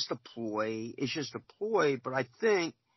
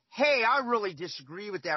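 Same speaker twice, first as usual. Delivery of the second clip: higher, pushier objection; disrespectful challenge; 6 semitones higher, 3 dB louder than usual. Shown as a demonstration. Player objecting to a referee's call, disrespectful. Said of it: "That's disrespectful".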